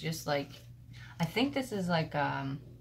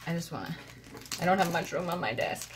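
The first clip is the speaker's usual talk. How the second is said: ordinary talk, nothing marked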